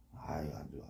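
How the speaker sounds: breathy whispered sigh of disapproval; whispered 아유, 안 좋아 to the crew. Real, not acted. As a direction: hush it down, almost no voice. Captain shaking head at bad fishing.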